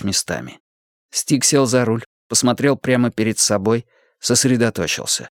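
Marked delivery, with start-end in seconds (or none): none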